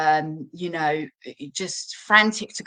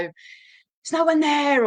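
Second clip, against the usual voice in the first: frustrated outburst; 8 semitones higher, 4 dB louder, much slower than usual. Retold or imitated. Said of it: "sort of shout".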